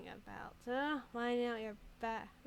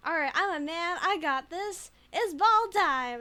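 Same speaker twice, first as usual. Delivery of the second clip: mock macho shout; 6 semitones higher, 9 dB louder than usual. Streamer pumping herself up as a man, loud mock macho. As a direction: bellow it like a gym bro. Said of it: "is that what men do".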